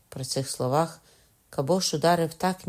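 ordinary delivery, nothing marked

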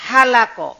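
Halako with a light breathed h, falling at the end; soft h. Shown as a demonstration. Teacher repeating the word list so students hear each h.

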